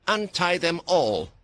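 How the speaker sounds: slow, firm command. A narrator's reading. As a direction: give the order calmly. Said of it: "Mia commanded".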